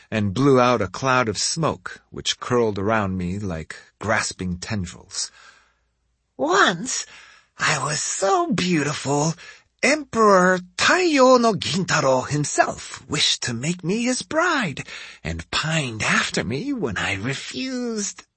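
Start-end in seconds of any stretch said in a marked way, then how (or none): 6.4-18.3 put-on old woman voice. A narrator's reading. Old lady voice for Hansho.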